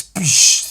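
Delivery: loud breathy explosive boosh; crashing sound effect. Retold or imitated. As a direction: burst it out like a rock smashing. Imitating smashing through rocks.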